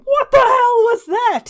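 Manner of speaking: high, loud bellow of outrage; full-voiced monster roar. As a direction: roar it out, stretching the words. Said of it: "he starts roaring".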